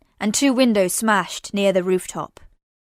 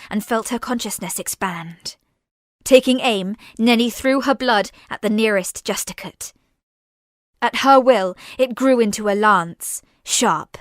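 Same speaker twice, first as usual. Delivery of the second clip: ordinary delivery, nothing marked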